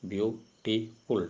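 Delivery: "beautiful" very slowly in three parts, beau... ti... ful; syllable by syllable. Shown as a demonstration. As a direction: break "beautiful" into three syllables with clear pauses. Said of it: "trisyllabic word".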